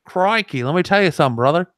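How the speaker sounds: high, exaggerated Australian wrestler patter; attempted Australian accent. Second go at the wrestler's catchphrase, attempted Australian accent. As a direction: ham up a faked Australian accent. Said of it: "I can't. I can't do it".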